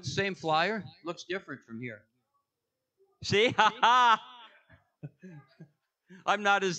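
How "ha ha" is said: loud, higher "ha ha"; loud laugh. Real, not acted. Speaker joking with congregation about flyer, loud laugh. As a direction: burst out with a loud ha-ha.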